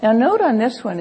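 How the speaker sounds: usual speaking voice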